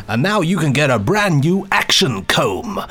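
slow hammed-up commercial read; lightly voiced fake commercial; mock advert voice. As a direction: sell the comb like a cheesy ad man. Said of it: "he was doing fake commercials".

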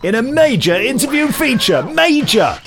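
rising to a high loud major; building hype. Real, not acted. Host hyping his major interview feature. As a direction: ramp up the excitement to the peak.

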